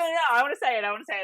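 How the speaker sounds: high, eager protest; keen to get the answer out first; eager insisting. Real, not acted. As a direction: beg to be the one who says it. Insists on saying it.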